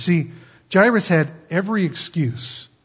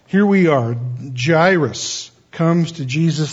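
usual speaking voice

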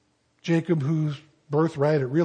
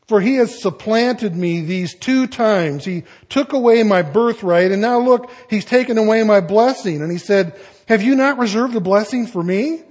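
louder and higher, aggrieved; indignant protest; 5 semitones higher, 8 dB louder than usual. Acted. Esau protesting to his father Isaac, louder indignant complaint. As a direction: accuse your brother, voice raised.

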